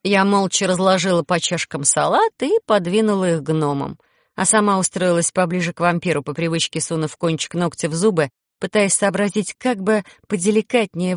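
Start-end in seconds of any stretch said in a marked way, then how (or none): none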